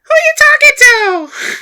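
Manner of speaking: very high, loud squeal; dolphin finger puppet. Acted. Little dolphin puppet butts in on Sal, piercing squeaky voice. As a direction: shriek it like a tiny dolphin. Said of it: "little dolphin finger puppet come over".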